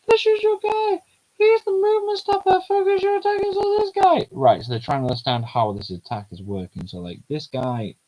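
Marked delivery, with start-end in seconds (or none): 0.1-4.3 put-on high-pitched girly character voice; squeaky young girl voice.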